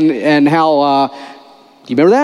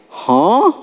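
baffled; 7 semitones higher than usual. Retold or imitated. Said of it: "Nicodemus is like".

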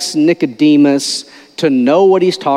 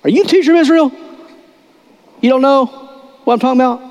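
high, disbelieving challenge; can't believe the teacher doesn't know; incredulous; 10 semitones higher, much faster than usual. Retold or imitated. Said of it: "Jesus goes".

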